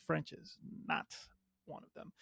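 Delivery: a sheepish whispered confession; whispered aside. Real, not acted. Vlogger admitting he can't speak French, whispered aside. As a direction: whisper it under your breath.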